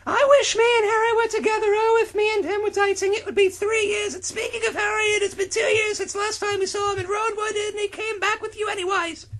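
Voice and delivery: put-on very high, loud girly falsetto; Jenny voice. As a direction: shriek it like a lovesick girl.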